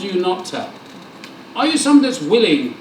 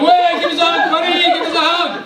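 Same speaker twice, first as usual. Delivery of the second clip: exuberant shout; 8 semitones higher than usual. Pretend. Alistair chasing a reluctant churchgoer for a hug, exuberant shout. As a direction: bellow it like an overjoyed friend.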